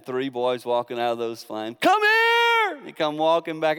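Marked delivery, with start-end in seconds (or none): 1.8-2.8 high, loud summons; mock royal shout; king calling out. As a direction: call them out of the fire.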